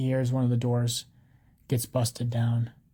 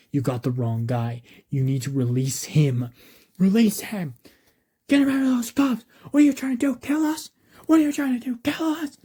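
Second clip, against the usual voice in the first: yelling at cops; 9 semitones higher, 5 dB louder than usual. A narrator's reading. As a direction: protest building from near-normal to high, loud cries. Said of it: "yelling at the cops".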